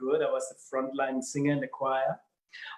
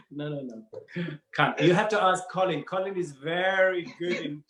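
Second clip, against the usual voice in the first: usual speaking voice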